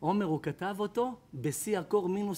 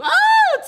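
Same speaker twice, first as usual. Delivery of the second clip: very high loud cry "אה" of pain; pained shout; 16 dB louder than usual. Retold or imitated. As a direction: let out a sharp shriek of pain. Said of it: "צועק לו". (צעקת כאב גבוהה)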